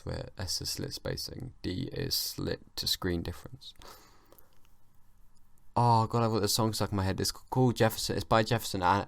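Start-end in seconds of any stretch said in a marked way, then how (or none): 0.0-3.7 slow whispered muttering; whispering. Real, not acted. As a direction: whisper it slowly to yourself. Muttering equations to self.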